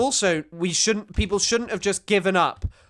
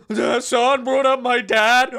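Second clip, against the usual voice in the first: mock blubbering, higher and slow; pathetic whiny apology; 6 semitones higher, 5 dB louder, slower than usual. Streamer mimicking a rival's pathetic apology, whiny mock sobbing. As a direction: whine it out with fake sobbing laughter. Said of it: "his pathetic, whiny apology of going".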